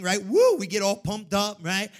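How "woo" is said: high, loud "woo"; excited whoop. Acted. Acting out churchgoers getting pumped up, loud whoop.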